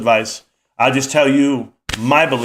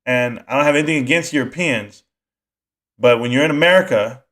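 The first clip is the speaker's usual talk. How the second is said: usual speaking voice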